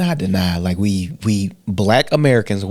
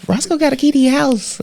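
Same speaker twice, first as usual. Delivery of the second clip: high put-on voice quoting his girlfriend; imitating Tiara. Retelling what Tiara said, in a high imitation. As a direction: mimic her in a high voice. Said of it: "Tiara said".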